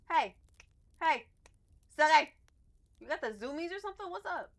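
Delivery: loud high call to the cat, then fast high cooing; high pet voice. Real, not acted. Calling her hyper cat Silky, high pet voice.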